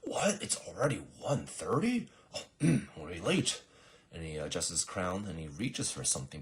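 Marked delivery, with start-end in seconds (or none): 0.0-3.6 high, loud startled king; mock alarm. King checking his watch, exaggerated alarm. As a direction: play up the shock of being late. Acted king sees the time.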